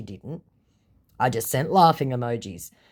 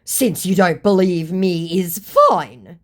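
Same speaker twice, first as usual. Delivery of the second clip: louder, higher, slow wounded indignation; indignant; 5 semitones higher, 7 dB louder, slower than usual. Retold or imitated. Scammer offended at being doubted, indignant. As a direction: act out a scammer's huffy offence. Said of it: "completely unironically indignant".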